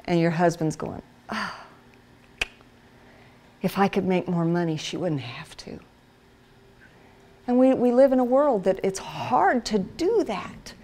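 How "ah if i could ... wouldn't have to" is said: much softer, low and weary; a hint of a dejected husband thinking; husband thinking about money, softly; mild sigh of regret. Husband's inner thought.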